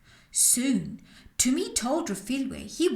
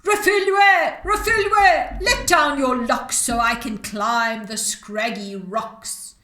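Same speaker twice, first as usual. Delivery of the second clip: a man doing the witch's shout; prince imitating the witch; 10 dB louder than usual. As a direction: fake the witch's call to fool the girl. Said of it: "imitating her voice, he yelled out".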